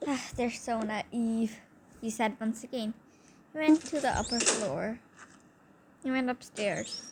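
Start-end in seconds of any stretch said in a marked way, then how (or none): 0.1-1.6 evil mock laugh. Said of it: "evil laughing".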